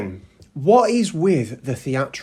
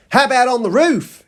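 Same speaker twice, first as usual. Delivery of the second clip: put-on high, loud Australian-accented question; 10 semitones higher, 5 dB louder than usual. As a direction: put on an Aussie accent, voice pitched up. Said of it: "in an Australian accent for some reason".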